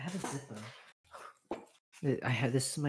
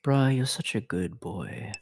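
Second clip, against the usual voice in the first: low, slow, drawn-out praise; impression of a top; 4 semitones lower, slower than usual. Shown as a demonstration. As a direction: play a dominant top, lower and slower. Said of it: "My impression of a top".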